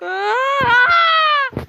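high, loud scream; a high, loud real scream while falling. Real, not acted. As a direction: scream as you drop.

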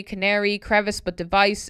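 usual speaking voice

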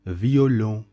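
slow violon with nasal final on; French violon. Shown as a demonstration. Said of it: "its pronunciation is V".

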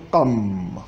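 "tam" ending on a long held mmm; final M. Shown as a demonstration. Teacher showing the second-person pronoun, held final M in "tam". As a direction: draw out the closing m of "tam". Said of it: "com M no final".

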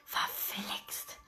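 hushed under-the-breath curse; whispered curse. A narrator's reading. Narrator catching his own blunder, whispered curse. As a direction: whisper it to yourself. Realizes time-zone mistake.

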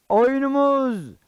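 big opening call; show announcer. Acted. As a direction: call the show open, stretching the words. Announcer opens the puppet show.

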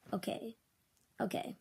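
ordinary talk

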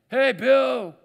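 loud high hail across a distance; calling out. Retold or imitated. Preacher calling across the valley, loud hail. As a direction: shout across the valley to a friend.

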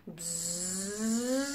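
low drawn-out buzz; big bee buzz. Acted. Teacher leading the children in a bee buzz. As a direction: buzz like the bumblebee. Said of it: "Let's give Bumblebee big, big buzz".

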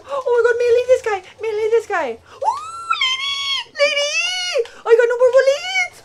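high, very loud panicked yelling; frantic screaming. Real, not acted. Player out of bullets yelling at lady, frantic screaming. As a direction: shriek urgently for the blow to land.

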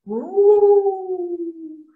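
loud, long sustained ooh; Papa Ghost wail. Retold or imitated. Papa Ghost left in the house, loud wail. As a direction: hold it like the father ghost. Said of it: "Papa Ghost going".

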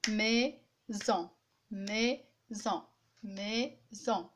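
"Maison" in two beats, mai, pause, son; clapped-out syllables. Shown as a demonstration. Teacher showing how to clap syllables, one beat each. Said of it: "je fais comme ça".

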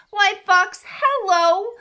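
high, loud sing-song hello; exuberant greeting. A narrator's reading. Mouse hails fox before Gruffalo, high and exuberant. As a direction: call out with showy delight.

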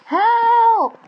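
high, loud, drawn-out yell; yelled cry for help. A narrator's reading. Mario and Luigi caught by the monster, yelling for rescue. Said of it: "Mario and Luigi yelled".

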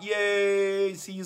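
high happy yay; excited cheer. Real, not acted. Host cheering for viewer who signed up. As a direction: cheer for a new signup.

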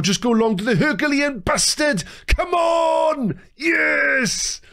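high, building celebration yell; high-pitched triumphant roar; ecstatic goal shout. Real, not acted. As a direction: cry out as the late equaliser lands. Late goal goes in.

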